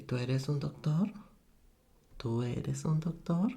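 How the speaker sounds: "Tú eres un doctor" with a rise on "doctor"; question intonation. Shown as a demonstration. Teacher showing how Spanish forms a question, rising intonation. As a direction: ask "Tú eres un doctor", lifting the final word. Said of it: "The only change are the intonation".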